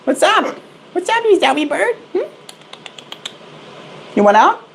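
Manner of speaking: very high squeaky pet voice; talking to a pet bird. Real, not acted. Calling bird out of cage.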